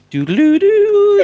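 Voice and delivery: high, loud, drawn-out electronic jingle; imitated phone tone. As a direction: sing out a little phone beep melody. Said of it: "could you replicate this tone".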